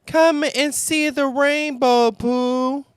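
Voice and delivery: high, eager little kangaroo call. Acted. Little Roo calling Pooh outside to see the rainbow, high and eager. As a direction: call your friend out to see it, bright and young. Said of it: "the little kangaroo called".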